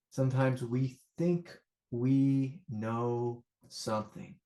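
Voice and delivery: "think we know something" stretched out slowly; slow, drawn-out emphasis. Real, not acted. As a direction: draw out each word of "think we know something". First take of repeated teaching line.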